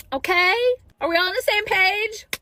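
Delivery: high, loud check-in to viewers; rally the audience hard; loud rallying call. Real, not acted.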